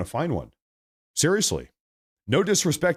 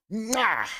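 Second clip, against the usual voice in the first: kiss sound; 9 semitones higher than usual. Acted. Streamer blowing a kiss for President Trump, smacking. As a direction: blow a big smooch at the camera.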